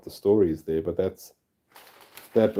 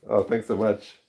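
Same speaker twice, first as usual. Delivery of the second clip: ordinary talk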